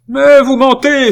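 very high, loud outraged shout; angry cry. A narrator's reading. Aunt calling her nephew a liar, furious. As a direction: cry the accusation out in fury. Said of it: "s'écria la vieille fille, dont la colère".

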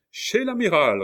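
very high, loud answering shout; echoed rallying cry. A narrator's reading. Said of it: "répéta la Hurière". (cri repris en écho, très aigu)

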